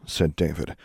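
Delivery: ordinary delivery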